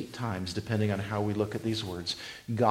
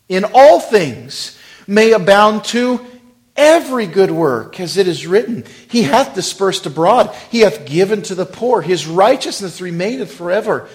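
loud, high preaching read; preached scripture; 7 semitones higher, 15 dB louder than usual. Acted. Preacher reading Corinthians aloud, loud and high. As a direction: proclaim the passage.

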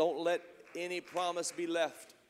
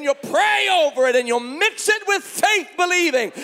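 high, loud, driving sermon shout; full-force preaching; 8 semitones higher, 11 dB louder than usual. Real, not acted. Preacher promising believers that goodness will grow, full-force shouted preaching. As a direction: shout it out as a mounting promise to the crowd.

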